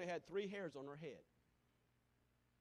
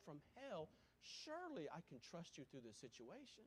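quiet prayer; 9 dB quieter than usual. Retold or imitated. Model prayer to God in trouble, soft. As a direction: pray it quietly, earnestly.

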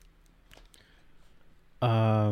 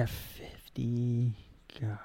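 whispered; 9 dB quieter, slower than usual. Real, not acted. Guessing under breath.

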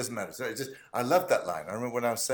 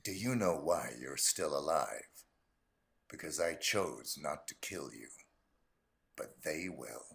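low, soft, menacing assassin; cold, hushed hitman threat; Agent 47; 8 semitones lower, 9 dB quieter than usual. Acted. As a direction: deliver it low and quiet, with measured pauses. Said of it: "another Agent forty-seven line".

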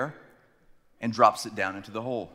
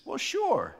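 high, easy "well, sure"; cheerful ready agreement; George voice; 12 semitones higher than usual. Retold or imitated. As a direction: friend agreeing to help, lightly. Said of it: "And he says".

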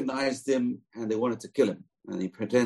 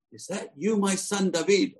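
Saul's voice; 10 semitones higher than usual. Acted. Old king answers David.

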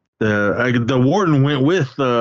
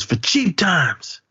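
mimicking killer; 8 semitones higher, much slower than usual. Killer's line, retold prison scene.

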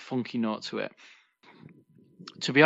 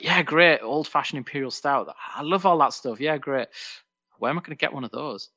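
higher, eager reenactment of own reaction; mock-excited retelling of yourself; enthusiastic self-quote; 4 semitones higher, 3 dB louder than usual. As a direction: act out your own keen excitement, pitched up. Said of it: "I was like".

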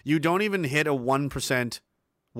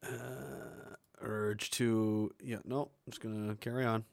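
trailing off; 3 semitones lower, 10 dB quieter, slower than usual. Real, not acted. Restrains himself after infuriating clip.

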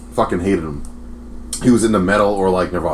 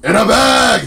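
high, loud robot-like stage yell; imitated robotic singer, belting it out; robotic frontman; 14 semitones higher, 7 dB louder, slower than usual. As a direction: finish the song title like a shouting robot. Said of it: "he talked like a robot".

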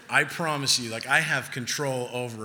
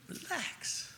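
whispered plea; 11 dB quieter than usual. Retold or imitated. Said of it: "I can find myself like".

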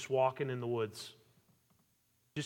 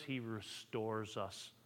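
tender hush; 5 semitones lower, 12 dB quieter, much slower than usual. Real, not acted. Tender point in sermon.

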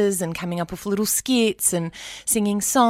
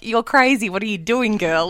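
self-teasing; 3 semitones higher, 5 dB louder than usual. Retold or imitated. Teasing herself after hanging up.

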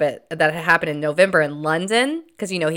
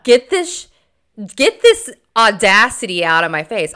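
outraged viewer; 2 semitones higher, 7 dB louder, slower than usual. Retold or imitated. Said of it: "you're like".

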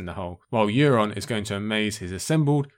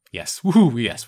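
excited cheer; 7 dB louder, slower than usual. Real, not acted. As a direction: cheer it out, thrilled. Cheering Asha's move.

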